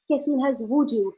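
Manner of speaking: ordinary delivery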